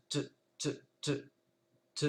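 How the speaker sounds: te, te: t seul, coupé net sans voyelle; stopped t. Shown as a demonstration. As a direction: claquer le t et s'arrêter. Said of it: "ça donnera tout simplement te". (t sec, sans voyelle)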